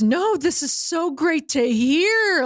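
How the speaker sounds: high, drawn-out happy gush; beaming delight. Retold or imitated. Friend retelling how he heard good news about his crush, beaming delight. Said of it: "he, he just, like, beamed".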